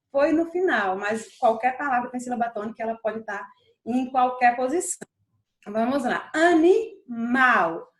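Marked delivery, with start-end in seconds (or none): 6.3-7.9 "Animal" very slow and loud, "mal" held; stress on last syllable. Shown as a demonstration. Teacher quizzing students on tonic syllable, "Animal" lengthened on "mal". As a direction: stretch "Animal" and punch the final "mal". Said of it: "Onde foi que eu passei mais tempo?".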